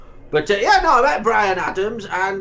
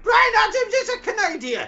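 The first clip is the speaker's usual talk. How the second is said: proud shout. Acted.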